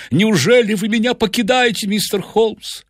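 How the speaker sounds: high-pitched, anxious pleading; panicked professor voice; shocked and frightened. A narrator's reading. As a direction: plead as if about to be abandoned. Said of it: "Профессор был поражён и напуган".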